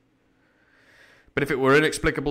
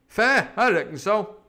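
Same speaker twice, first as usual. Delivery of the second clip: slow, high pirate retort; indignant sea cook; 8 semitones higher, 5 dB louder than usual. Acted. Sea cook answers old man Morgan, indignant. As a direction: throw it back in scornful pirate voice.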